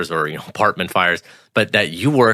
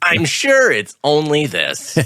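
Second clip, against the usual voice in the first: very slow, high put-on voice of false calm; mock naive reassurance; 7 semitones higher, much slower than usual. Co-host mocking plant workers downplaying danger, slow and high. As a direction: drag it out, playing the clueless optimist.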